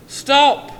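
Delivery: high, very loud yell. A narrator's reading. Anne yelling after him, very loud shout. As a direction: bellow it to make him halt. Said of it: "yelled Anne".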